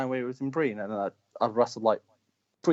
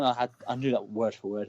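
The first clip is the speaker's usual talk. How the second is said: usual speaking voice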